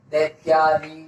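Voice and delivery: low, measured दैत्यारी, syllable by syllable; drawn-out answer. Shown as a demonstration. Teacher answering own question for students, drawn out. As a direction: spell out दैत्यारी by ear, stretching each syllable.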